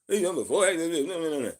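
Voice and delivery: loud mock disbelief at strange jargon; incredulous mock outburst. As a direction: scoff like a baffled skeptic hearing nonsense. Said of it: "and only few like".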